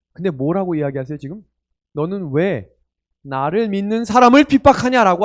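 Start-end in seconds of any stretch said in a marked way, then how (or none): 1.9-5.3 너는 왜 나를 믿는 사람을 핍박하냐 said high and much louder, as the wrong version; rejected wording, loud. Shown as a demonstration. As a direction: belt out the version Jesus did not say. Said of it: "하지 않고". (틀린 말로 크고 높게 외치듯)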